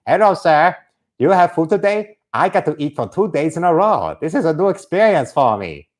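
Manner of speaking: mock Korean accent, working the crowd; eager Korean-accented comic; North Korean comedian. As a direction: greet the audience in caricatured Korean English. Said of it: "imagine a North Korean comedian interacting".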